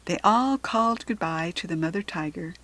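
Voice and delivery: ordinary delivery, nothing marked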